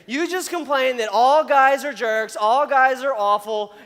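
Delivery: loud acted objection, stressing each complaint; exasperated rebuke. Answering the girl's prayer after her griping, loud mock exasperation. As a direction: throw their words back at them, loudly.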